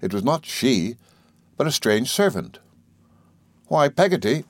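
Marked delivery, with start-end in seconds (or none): 3.7-4.4 put-on higher-pitched, rueful boy; mildly rueful child's question. A narrator's reading. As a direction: ask it with a touch of rue. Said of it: "I said ruefully".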